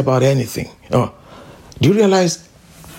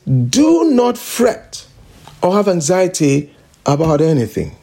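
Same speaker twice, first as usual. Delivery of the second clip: drawn-out, solemn reading of Do not fret; preacher reading the verse very slowly; slow scripture reading. Shown as a demonstration. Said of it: "it says".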